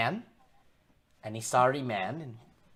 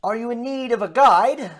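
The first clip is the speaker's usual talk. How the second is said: high, loud, projected character voice; loud, raised-pitch stranger; stranger at the door; 9 semitones higher, 10 dB louder than usual. Acted. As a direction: voice a traveller calling through a door. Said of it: "and he says".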